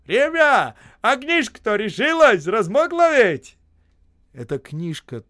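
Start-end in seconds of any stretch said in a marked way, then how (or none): 0.1-3.6 loud, high merry call; cheerful shout. A narrator's reading. Osip gleefully shouting to the men. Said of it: "он весело кричит".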